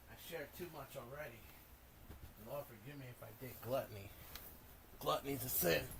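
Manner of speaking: solemn, hushed wise man voice; wise old sage. Acted. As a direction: speak softly like a preaching prophet. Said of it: "I'm tired of talking like a wise man".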